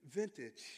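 "vintage" dropped low and soft as a reveal; unveiling the new word to the congregation, hushed; dramatic reveal. Shown as a demonstration. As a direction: let "vintage" land low and quiet after a beat. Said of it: "watch this".

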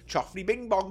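mock babble. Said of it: "That's what I hear when I hear you".

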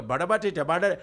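quick Indian-sounding gibberish; mock Indian language. Showing how the language sounds, fast mock Indian. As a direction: rattle syllables in an Indian accent. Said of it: "look at Indian when they speak".

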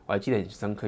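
usual speaking voice

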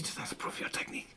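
put-on fast, hushed aside in a French accent; whisper. Waiter whispering to staff about the lady, French accent. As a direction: whisper it behind the customers' backs.

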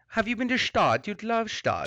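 put-on a Stuttgart local selling his hometown in a German accent; mock German accent. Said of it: "like a German".

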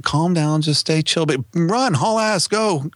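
rising from steady urging to a high frantic push; from calm down to a frantic haul ass; calm then frantic. Retold or imitated. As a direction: start calm and build to a high-pitched hurry. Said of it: "And I'm like".

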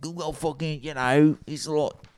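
put-on high-pitched, awful British chef accent; terrible British accent. Cooking-video chef scolding Americans, in a terrible British accent. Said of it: "that was the worst accent on earth".

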